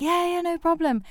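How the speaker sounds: high, sing-out assurance; breezy agreement. Retold or imitated. Actress assuring the director about the accent, breezy.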